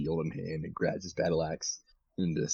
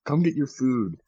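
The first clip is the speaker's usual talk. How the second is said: calling out; 5 semitones higher, 5 dB louder than usual. Acted. Character calls others to eat.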